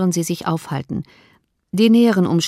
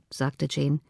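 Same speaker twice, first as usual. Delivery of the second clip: usual speaking voice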